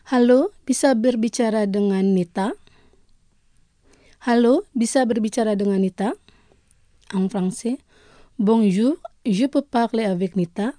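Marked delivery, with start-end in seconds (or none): 4.3-6.1 the same phrase rattled off fast; quicker repeat. Shown as a demonstration. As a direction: run the phrase through quickly the second time. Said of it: "deux fois". (répété rapidement)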